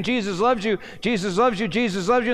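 high, rapid-repeat mock cry; retold screaming. Preacher retelling Alex's persistence, mild imitated scream. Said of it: "Alex kept screaming".

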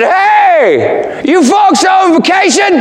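put-on high, bright old southern man calling out. Old man greeting Fred at his table, high old-timer voice.

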